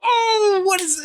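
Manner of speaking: very high mock-amazed voice; high-pitched, hammed-up wonder; amazed reaction. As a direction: squeal it in mock astonishment. Retelling a commercial's amazed reaction.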